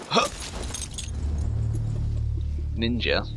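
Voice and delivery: usual speaking voice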